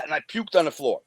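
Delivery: normal talking voice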